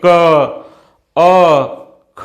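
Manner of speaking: क, अ, ख each voiced alone as a syllable with a short "a" vowel; demonstrating the separate sounds क, अ, ख; letter sounds pronounced. Shown as a demonstration. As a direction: sound out क, अ, ख one at a time, with pauses between. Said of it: "वर्ण को जो हम उच्चरित करते हैं".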